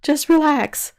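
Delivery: high, loud soothing talk; soothing the cat. Retold or imitated. Calming the yowling cat.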